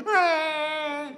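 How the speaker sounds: put-on a toddler's sudden attention wail; baby's cry. Preacher voicing a baby crying once prayer ends, mock wail. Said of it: "they go".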